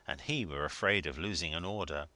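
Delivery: plain reading voice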